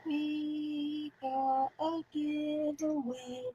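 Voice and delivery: stretched slowly, high and soft; slow drawn-out echo. Acted. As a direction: draw every word out slowly. Echoing giveaway, drawn out.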